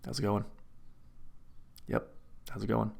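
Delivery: low, soft, falling dad hello; dad greeting. Retold or imitated. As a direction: mumble it like a passing dad nodding to a stranger. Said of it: "It's so dad to be like".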